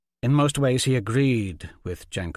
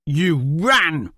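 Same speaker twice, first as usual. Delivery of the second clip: loud, higher shouted charge; angry accusation; 6 semitones higher, 8 dB louder than usual. Acted. Shouts at Stefan that he ran, loud accusation. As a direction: fling it back.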